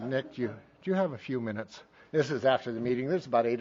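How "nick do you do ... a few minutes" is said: soft, low, hesitant ask; humble request. Retold or imitated. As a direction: act out a meek little request. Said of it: "in my most humble way".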